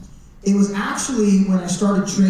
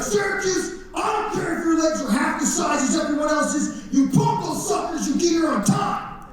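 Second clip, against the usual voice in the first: much higher, hammed-up drill-sergeant yell; barking guard; 7 semitones higher than usual. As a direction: chew him out for being late. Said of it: "they'd be like".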